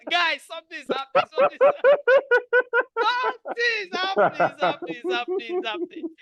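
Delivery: a gleeful chant of something's happening; excited singsong tease. Real, not acted. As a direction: tease the crowd with the news. Chanting teasing news.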